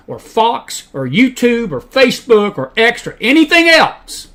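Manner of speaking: slow list climbing to a high, loud "anything else"; emphatic list. Real, not acted. Preacher ruling out media sources, slow stress building to "anything else". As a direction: punch each name, then peak on "anything else". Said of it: "I cannot stress this enough".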